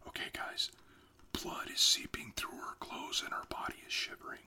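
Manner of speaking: hushed whisper aside. Real, not acted. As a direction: whisper so she won't hear. Aside to viewers during trance.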